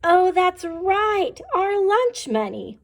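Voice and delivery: mock shout. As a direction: shout it out together as kids. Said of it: "we shout".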